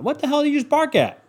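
high sudden shout at a barking dog; yelling at the dog. Real, not acted. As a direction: snap at the dog out the window.

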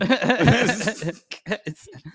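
high burst of laughter; big real laugh; loud laugh. Real, not acted. Laughing at own joke.

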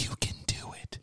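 soft, unvoiced whisper; hushed, airy pep talk; whispered encouragement. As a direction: whisper it like a guardian angel urging someone on. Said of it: "he's pretty much like".

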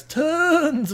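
long, rising swell on "tons"; drawn-out emphasis. Real, not acted. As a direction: draw out "tons" to show just how many. Stressing how many stories.

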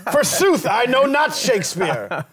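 high, theatrical mock Shakespearean voice, laughing; renaissance fair olde speech. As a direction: ham it up like a stage bard. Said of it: "That's how they talk".

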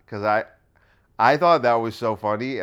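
ordinary talk, nothing marked

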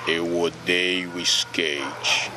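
the voice of God, deep and drawn out; Cid Moreira voice. Retold or imitated. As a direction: intone it slowly like a grave TV news anchor. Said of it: "com a voz do Cid".

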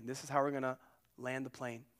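ordinary talk

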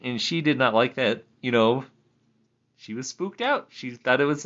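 usual speaking voice

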